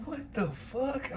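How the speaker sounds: very high, slow incredulous question; retold disbelief. Reacting to his vile boast, high drawn-out disbelief. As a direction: stretch it out high, aghast in the retelling.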